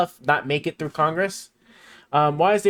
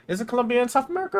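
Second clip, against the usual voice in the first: surprised; 10 semitones higher, faster than usual. Real, not acted. Doubting his own geography.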